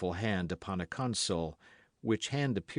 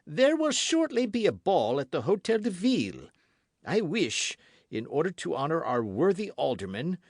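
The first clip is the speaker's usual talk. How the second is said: higher, louder, stately royal command; king with dignity; 7 semitones higher, 4 dB louder than usual. A narrator's reading. King ordering the queen to wear the studs, stately and commanding. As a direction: decree it like a king who knows his power. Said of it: "with dignity".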